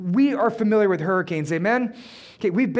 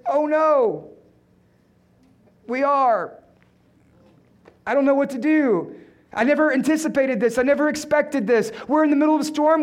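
higher-pitched, badly faked panic; hammed-up alarm with long pauses; mock dismay; 4 semitones higher than usual. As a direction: play a helpless leader losing it. Imagined Jesus panicking like the disciples.

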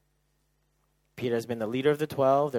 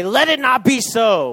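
Peter's rebuke; 11 semitones higher, 16 dB louder than usual. Acted. Peter rebuking Jesus, acted.

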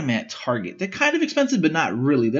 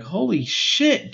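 a slow, drawn-out "Holy shit", pitched higher; mock amazement; 6 semitones higher, much slower than usual. Friends waking up to a cleaned backyard, amazed. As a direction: voice the friends' stunned reaction.

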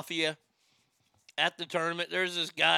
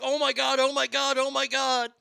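high excited repeated exclamation; hammed-up retold excitement; excited oh my God; 9 semitones higher, 5 dB louder than usual. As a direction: squeal it out, cracking up at the picture. Retelling his own laughing reaction.

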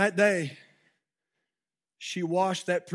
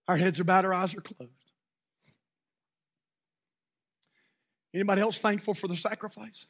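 closing revival prayer over music; altar prayer; 3 semitones higher than usual. Acted. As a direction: lead the congregation gently in prayer.

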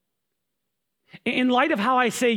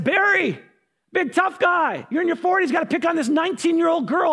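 put-on jab at him for picking on a teen; angry confrontation; 7 semitones higher than usual. Brother confronting the dad who yelled at his sister, angry taunt.

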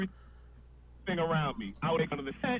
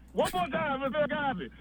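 announcer; 9 semitones higher than usual. Retold or imitated. Announcer voice mocking Cosby.

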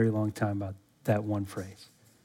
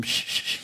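soft, voiceless repeated shushing; hushing shh; 8 dB quieter than usual. Acted. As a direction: shush someone quietly, in short bursts. Mimics disciples hushing the man.

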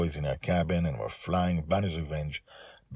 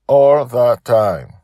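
much louder, slow insistence; emphatic repeat; 6 semitones higher, 12 dB louder, much slower than usual. Acted. Dragon Father repeating to his daughter, loud and slow. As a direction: hammer the point home.

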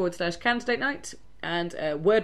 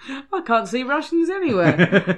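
laughing; 6 semitones higher, 7 dB louder than usual. Retold or imitated. Mocking the quoted lady.

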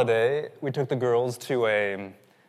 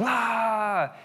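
high, drawn-out "aah"; mock panic scream; 9 semitones higher than usual. Preacher mimicking people who think they're drowning, high mock wail. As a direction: act out helpless flailing panic. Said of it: "We just panic".

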